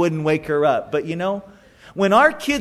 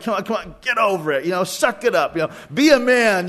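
dismissive dad; faster than usual. Acted. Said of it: "dad's like".

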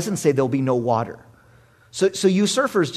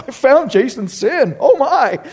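mock surprise; 8 semitones higher, 5 dB louder, slower than usual. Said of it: "someone's like".

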